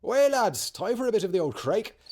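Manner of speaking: high, loud, hammed-up bad Irish accent; terrible Irish accent. Irish nationalists celebrating, in a deliberately bad Irish accent. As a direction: cheer it like a stage Irishman, badly. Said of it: "Sorry for my terrible Irish accent".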